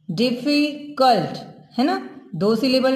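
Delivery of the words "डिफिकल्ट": slow "डिफिकल्ट", split as "डिफी", pause, "कल्ट"; syllable by syllable. Shown as a demonstration. Teacher showing two parts of a word, "डिफिकल्ट" broken before "कल्ट". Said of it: "डिफी अलग से बोला गया".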